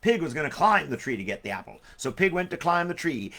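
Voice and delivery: ordinary delivery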